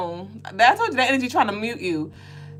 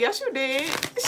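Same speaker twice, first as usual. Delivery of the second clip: mom's reply; 5 semitones higher, 8 dB quieter, slower than usual. Retold or imitated. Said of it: "She was like".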